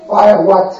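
ordinary talk, nothing marked

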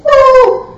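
loud, very high beam noise; high whooshing "ooh". Acted. Huge flashlight from angel's hands, loud high whoosh. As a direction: imitate a blast of light, high and loud.

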